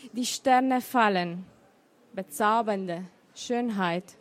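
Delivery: measured recital with pauses between the lines; poem recital. Acted. Poet reads her poem to the listeners, lightly measured. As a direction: let the refrain "Ich renne gegen die Zeit" carry the poem. Reading announced poems aloud.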